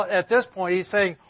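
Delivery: ordinary talk, nothing marked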